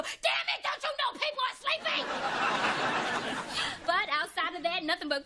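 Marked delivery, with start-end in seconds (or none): 0.0-2.0 high, shouted mock yell at the garbage truck; yelling out the window. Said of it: "I have to yell out the window".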